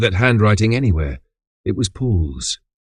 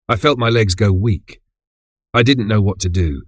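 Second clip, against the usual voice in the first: ordinary delivery, nothing marked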